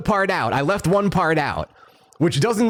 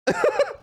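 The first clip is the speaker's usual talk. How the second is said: high laugh. Real, not acted. Laughing at his own story.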